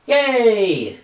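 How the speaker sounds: high squeaky crowd cheer; gallery cheer. Pretend.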